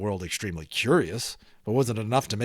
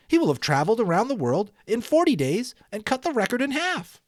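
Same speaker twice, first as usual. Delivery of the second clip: put-on lightly higher boyish voice; an eager boy working it out; young boy; 11 semitones higher than usual. A narrator's reading. Boy reasoning excitedly to the townsfolk, higher voice. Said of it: "the young boy reasoned".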